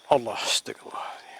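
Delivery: breathless whispered astagfirullah; whispered prayer. Real, not acted. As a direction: whisper it in fear. (bisikan doa ketakutan)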